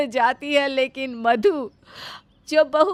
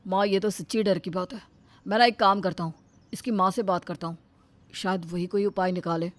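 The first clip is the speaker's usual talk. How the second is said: lower, cheerful son coming home; a lighter male son's voice, lower; 7 semitones lower, 6 dB quieter, faster than usual. Acted. Son arriving home, sniffing the cooking, pleased.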